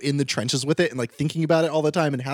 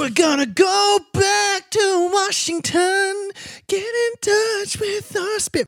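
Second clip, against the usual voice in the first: dramatic high voice; much slower than usual. Pretend. Dramatic announcement over music.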